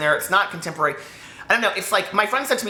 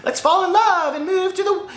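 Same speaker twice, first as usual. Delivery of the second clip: very high, loud lovestruck gushing; hammed-up romantic heroine squeal; mock swooning romance; 14 semitones higher, 3 dB louder than usual. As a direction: gush dreamily, sending up a fairy-tale ending. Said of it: "and she's like".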